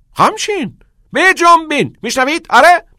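very high, loud, staccato commands; excited, pressing orders; urging. Acted. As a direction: hurry the boys along, shouting. Teacher urges boys to lean in.

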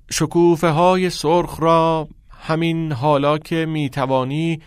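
laboured classroom poetry reading; pupil reciting verse. Acted. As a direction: read the poem aloud word by word.

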